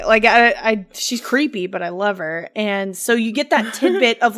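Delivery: usual speaking voice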